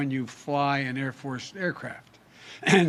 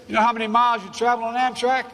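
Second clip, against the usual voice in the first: higher, louder put-on voice of a gruff old train conductor; hammed-up conductor teasing his famous passenger; conductor's voice; 9 semitones higher, 5 dB louder than usual. Conductor teasing the vice president about flying miles, in a higher, louder put-on voice. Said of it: "went up to New Jersey for the dinner".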